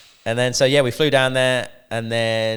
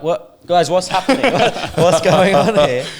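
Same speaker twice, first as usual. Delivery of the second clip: laughing; 8 semitones higher, 9 dB louder than usual. Retold or imitated. Retold panic, laughing through it.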